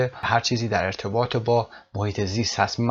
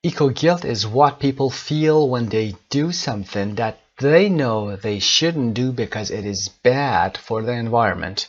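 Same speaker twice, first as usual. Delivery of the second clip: 1 slow model reading; much slower than usual. Shown as a demonstration. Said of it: "teacher reads the definition slowly".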